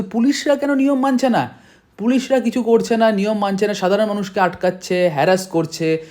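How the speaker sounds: high, heated outburst; angry. Real, not acted. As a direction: pitch rising in real frustration. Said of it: "মানে রাগ উঠছে দেখে আমার".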